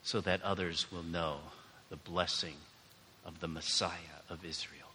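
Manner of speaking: soft, low prayer with long pauses. Real, not acted. Pastor leading the congregation in prayer, soft praying.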